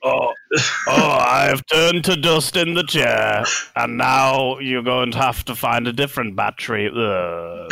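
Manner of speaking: hammed-up deathbed lament, falling away; dying Malcador. Plays one turned to dust.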